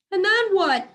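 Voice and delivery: high, loud anxious question; a pup asking in alarm; young dog's worried question. Acted. A young dachshund asking anxiously what happens next.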